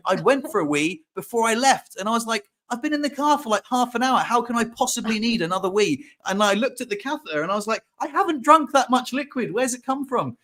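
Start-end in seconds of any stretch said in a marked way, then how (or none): 2.7-6.0 high-pitched indignant protest; incredulous, retold complaint pitched right up; annoyed disbelief. Arguing with own bladder in the car, annoyed. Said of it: "thing that annoyed me". 8.0-10.4 high, incredulous questioning; retold bafflement, voice pitched way up; stressed disbelief. Looking at a full catheter, stressed and incredulous. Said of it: "thing that was stressing me out".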